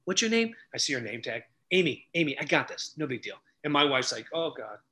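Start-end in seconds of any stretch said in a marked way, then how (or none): 0.0-3.4 softer, low-key aside; understated "leave it to me"; casual reassurance. Retold or imitated. Quietly taking over from cashier. 4.3-4.8 soft, low groan of resignation; imitating an embarrassed wife; wife's quiet dread. Wife dreads what follows.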